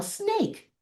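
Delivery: "Snake" in a high, lightly voiced child's call; imitating kids calling out "Snake"; a hint of kids shouting the answer; kids yelling. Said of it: "The kids would yell".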